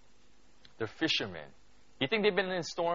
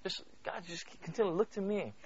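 soft coaxing; 4 semitones higher, 9 dB quieter than usual. Retold or imitated. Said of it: "the Lord always looks and says".

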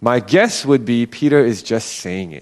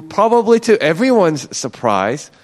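normal talking voice

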